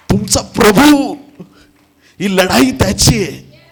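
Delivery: high, loud preaching shout; loud proclamation. Real, not acted. Preacher tells congregation the battle is the Lord's, loudly proclaiming.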